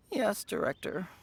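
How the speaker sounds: very low, soft, defeated; resigned compliance. Acted. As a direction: give in with a sunken, quiet voice. Assistant gives in to director.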